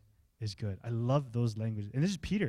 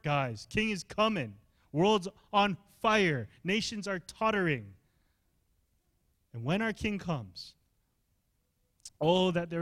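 urgent, emphatic preacher's charge; impassioned preaching; 6 semitones higher, 4 dB louder than usual. Real, not acted. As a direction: drive each phrase home to the congregation.

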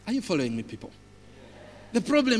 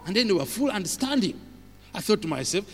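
ordinary talk, nothing marked

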